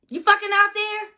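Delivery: high, loud angry yell; angry shout. Retold or imitated. Yelling into the empty house, angry. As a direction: yell it out, fed up.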